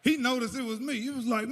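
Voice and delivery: ordinary talk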